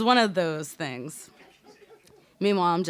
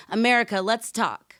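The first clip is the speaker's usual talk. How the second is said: lightly voiced comic growl, higher and louder; a hint of Bernie Mac calling out the nation; mock growl of a comedian; 5 semitones higher, 6 dB louder than usual. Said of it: "Bernie Mac growling".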